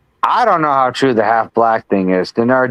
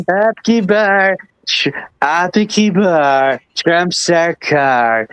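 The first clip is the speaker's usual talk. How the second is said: put-on slow, pitched-up Trump drawl with American-accented Hindi; Trump impression; 4 semitones higher, slower than usual. Mocking Trump courting the Indian vote, slow drawl. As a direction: ham up Trump pitching himself to Indian voters. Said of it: "in his own, you know, accent".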